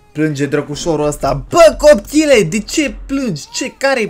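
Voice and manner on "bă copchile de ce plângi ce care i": exasperated scolding. Pretend. Player scolding the crying baby, high exasperated shout. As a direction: burst out at a crying child, then ease slightly.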